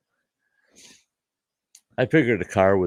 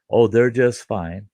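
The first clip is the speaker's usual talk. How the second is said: dismissive; 4 semitones higher, 6 dB louder, slower than usual. Retold or imitated. Brother dismissing kids.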